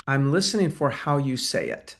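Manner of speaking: normal talking voice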